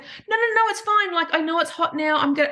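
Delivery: high-pitched, insistent no no no; anxious denial. Retold or imitated. Owner insisting to the vet her dying pug is fine, high anxious denial.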